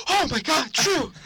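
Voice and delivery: high, loud scolding exclamation; imitated mom's yell. Mom finding one son tickling the sick one, yelling. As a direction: cry out the name in exasperation.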